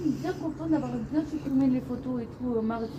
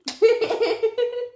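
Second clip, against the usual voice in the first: high tipsy giggle; 13 semitones higher than usual. Real, not acted. Tipsy giggle after "je suis soft. (petit rire aigu et éméché)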